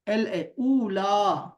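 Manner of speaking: ایل اے، اولا spelled out very slowly; slow teacher spelling; slow dictation. Shown as a demonstration. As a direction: drag out اولا for the students to copy. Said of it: "ایل اے نہ لکھو بلکہ اے double اے لکھو".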